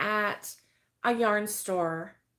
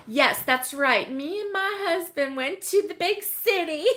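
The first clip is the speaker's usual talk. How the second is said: hammed-up country mouse twang, higher and louder; 5 semitones higher, 4 dB louder than usual. Streamer jokes about going to the big city, hammed-up country mouse accent. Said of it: "You sound like a country mouse".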